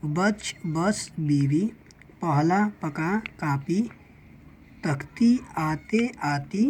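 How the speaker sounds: slow, separated reading of each word; slow word-list reading. Shown as a demonstration. Teacher reviewing the word list with the class, slowly. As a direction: read each word alone with a pause between, like a drill. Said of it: "एक बार और इस चीज़ को पढ़ लेते हैं".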